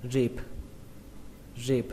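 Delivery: "rib" with a brief, short "i"; short vowel. Shown as a demonstration. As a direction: keep "rib" short, ending on a soft b. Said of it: "các bạn đọc ngắn thôi". (đọc "rib" với âm i ngắn)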